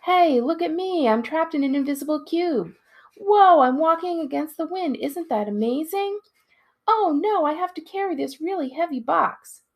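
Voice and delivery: high, loud, hammed-up showman calls; yelled out. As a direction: overplay every word for the crowd. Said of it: "I yelled it out in a totally obvious way".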